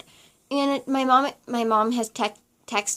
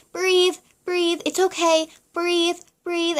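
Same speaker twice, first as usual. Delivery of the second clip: imitating soothing nurse; 7 semitones higher, slower than usual. Nurse coaching her breathing.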